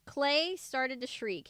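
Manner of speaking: usual speaking voice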